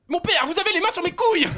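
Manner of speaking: high, loud shocked yell; outraged shout. Acted. Penitent yells at the priest groping him, high and loud. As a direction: cry out in mock outrage.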